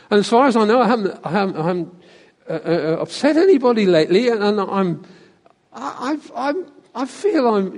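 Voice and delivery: imitating a fumbling answer with false starts; hesitant self-justifying churchgoer. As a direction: stammer through a lightly acted excuse, trailing into uncertainty. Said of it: "the answers you got".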